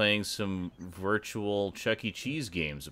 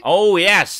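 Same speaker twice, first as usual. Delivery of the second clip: mock eager; 7 semitones higher, 7 dB louder than usual. Mock enthusiasm.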